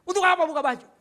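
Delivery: high, loud indignant challenge; angry demand. Retold or imitated. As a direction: snap the question back. Prisoner demanding an explanation.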